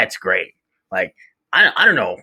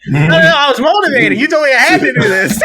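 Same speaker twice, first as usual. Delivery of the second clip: higher, louder, fast protest; 9 semitones higher, 5 dB louder, faster than usual. Retold or imitated. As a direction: insist you were motivated, laughing. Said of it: "I'm like".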